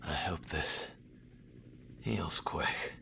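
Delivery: wounded, barely voiced; faint injured whisper; weak whisper. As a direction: breathe the words out in pain. Wounded soldier fading.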